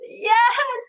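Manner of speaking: put-on high little toddler yes; child's yes. Toddler admitting the bead, high tiny yes. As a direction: chirp it like a small child. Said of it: "And she's".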